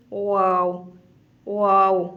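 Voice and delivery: واو with lips tightly rounded. Shown as a demonstration. Teacher demonstrates the واو sound to students. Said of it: "واو کے لیے ہونٹ گول کیے جاتے ہیں".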